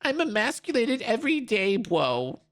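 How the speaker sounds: high sarcastic parody of the protester; mocking imitation. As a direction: parrot him in a high whiny voice. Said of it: "repeats the protester".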